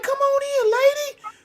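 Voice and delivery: very high, comic exasperation; exasperated invitation. Retold or imitated. Man holding the door for a woman, high exasperated plea. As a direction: squeal it like an impatient door-holder.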